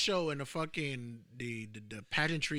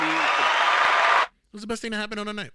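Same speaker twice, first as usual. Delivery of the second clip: loud, emphatic repeat over the other speaker; 6 semitones higher, 12 dB louder than usual. Real, not acted. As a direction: say it again much louder to be heard. Repeats it to be heard.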